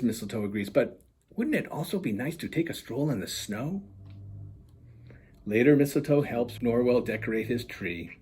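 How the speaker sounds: lively storybook reading voice; animated children's book narration; story narration. A narrator's reading. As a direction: read it like bedtime picture book. Author reads picture book aloud.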